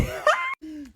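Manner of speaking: very high exasperated squealing laugh; shriek-laugh in disbelief; high shriek of laughter. Retold or imitated. Reacting to boyfriend not answering, shrieking laugh.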